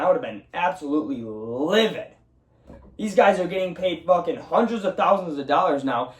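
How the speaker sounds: ordinary talk, nothing marked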